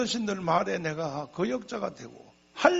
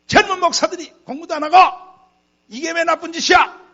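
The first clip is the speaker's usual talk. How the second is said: loud, high, fast mock outburst of an angry elder; imitating angry shouting; 10 semitones higher, 15 dB louder than usual. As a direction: shout a furious rebuke as the offended senior pastor. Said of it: "막 하도 소리쳐서". (화난 어르신을 흉내 내며 버럭 호통)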